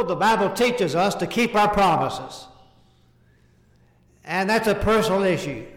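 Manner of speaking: ordinary talk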